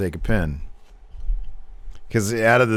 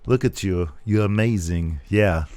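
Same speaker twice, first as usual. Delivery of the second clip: Arnold voice; 2 semitones lower, 3 dB quieter than usual. Retold or imitated. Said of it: "I'm talking like Arnold".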